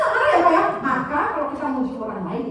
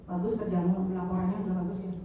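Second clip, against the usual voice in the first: softer, bored, dutiful compliment; unenthusiastic praise; 8 semitones lower, 8 dB quieter than usual. Shown as a demonstration. Boss praising a staff report, bored and softer. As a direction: praise the staff without any interest. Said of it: "seperti ini".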